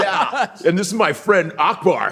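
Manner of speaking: high, hammed-up American accent; overly proper American accent. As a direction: parody a very American kid introducing a friend. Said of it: "All Nigerian kids speak proper".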